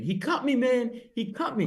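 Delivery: high mock yell; hammed-up wounded scream; retold scream. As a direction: yell it like a cut drunk. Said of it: "he starts screaming".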